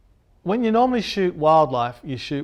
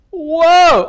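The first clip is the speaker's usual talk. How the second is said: a sudden high, loud cry of alarm; startled whoa; 13 semitones higher, 12 dB louder than usual. Retold or imitated. Startled by a charging elephant, he cries out in alarm. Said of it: "I'm like".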